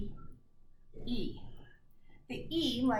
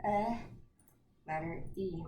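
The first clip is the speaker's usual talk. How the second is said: usual speaking voice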